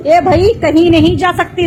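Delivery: laugh, then speak through it; amused and mocking. Real, not acted. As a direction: still laughing as she answers. Laughs at the comment.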